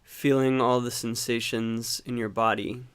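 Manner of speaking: calm meditation guide, long unhurried pauses; guided meditation. Acted. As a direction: gently guide them into watching the breath, leaving space between phrases. Leads a breathing meditation.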